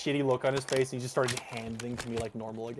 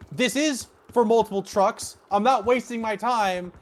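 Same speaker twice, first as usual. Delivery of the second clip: slow, high, loud telling-off; retold snapping back; 11 semitones higher, 7 dB louder than usual. Worker snapping back at yelling coworker, slow loud retort. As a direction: put her in her place, slow and loud. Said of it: "looked at her and says".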